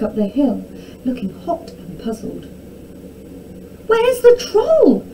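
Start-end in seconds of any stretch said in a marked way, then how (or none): 3.9-5.0 high, loud, drawn-out question; townsfolk demanding. A narrator's reading. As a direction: demand it urgently as a crowd. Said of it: "they asked".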